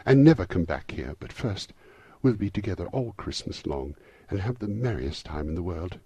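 put-on gleeful little girl. A narrator's reading. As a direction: promise it with childlike delight. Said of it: "brimful with glee".

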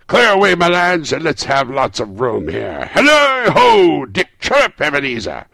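loud, high, merry orders; hearty boss hollering to his lads; jovial cry. A narrator's reading. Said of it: "cried old Fezziwig".

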